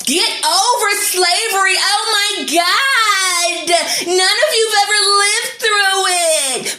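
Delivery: high, slow whiny mock of them. Mocking people who tell her to get over slavery, high whine. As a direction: whine it like a fake-sobbing mocker. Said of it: "It's okay for you to tell us".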